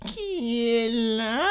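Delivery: put-on high, slow, quavering old woman; frail granny calling from bed; old grandmother. Grandmother in bed, high and slow.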